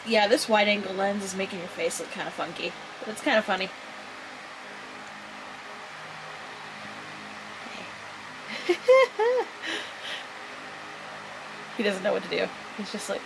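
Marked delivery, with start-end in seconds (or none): 3.8-11.7 high-pitched amused laughter; airy little bursts of laughter; giggle. Real, not acted. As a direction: giggle at a funny face. Amused by pet's face.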